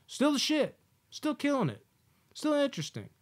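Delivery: high, emphatic praise; excited praise. Real, not acted. Host hypes the show to fans, excited and high. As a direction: hype the show up. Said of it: "praising the show".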